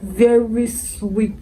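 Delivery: "very sweet" said slowly, stretching "sweet"; stress on sweet. Real, not acted. Reviewer repeating her verdict on the perfume, slow stress on "sweet". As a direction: draw out "sweet" with heavy emphasis. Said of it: "Emphasis on sweet".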